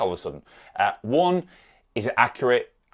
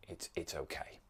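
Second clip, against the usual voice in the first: soft unvoiced whisper; whispered reassurance; 2 semitones lower, 16 dB quieter than usual. Shown as a demonstration. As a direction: whisper it to calm yourself. Reassuring rational thought demo.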